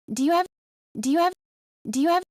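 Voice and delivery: "Do you have" said high and quick as "do you 'ave"; dropped H in have. Shown as a demonstration. Teacher repeating the clip with the H dropped. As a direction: drop the h so "have" starts on the vowel. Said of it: "drop the H".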